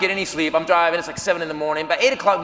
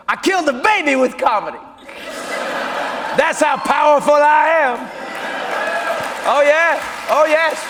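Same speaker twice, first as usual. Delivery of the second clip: mock boast; 6 semitones higher than usual.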